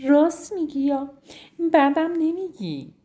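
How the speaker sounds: high cheerful giraffe voice after a laugh. Acted. Zizi the giraffe laughing at the rhino's idea, cheerful. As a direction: say it with a happy laugh. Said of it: "خندید و گفت".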